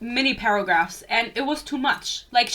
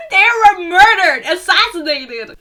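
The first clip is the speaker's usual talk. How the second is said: loud, high and half laughing; incredulous shout with a laugh in it; exasperated outburst; 7 semitones higher, 11 dB louder than usual. Real, not acted. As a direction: burst out in disbelief, much louder. Exasperated outburst at the book's wording.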